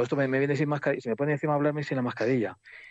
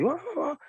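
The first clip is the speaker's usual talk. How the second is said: high-pitched mock raving noises; imitación of shouting. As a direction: imitate a raver's wordless cries, high up. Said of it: "que van dando voces ahí". (imitando voces desquiciadas, muy agudo)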